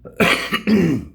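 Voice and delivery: chesty cough from a cold; real cough, sick and chesty. Real, not acted. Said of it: "Pardon me, guys".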